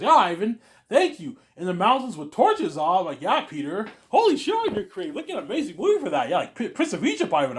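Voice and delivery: high, loud, laughing delight; laughing excitement. Pretend. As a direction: gush with laughing, delighted disbelief. Imagined thrilled reaction to Peter's idea.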